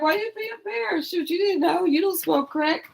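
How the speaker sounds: high mock-incredulous tease; mother teasing embarrassed daughter, high-pitched. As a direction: tease her in a squeaky voice.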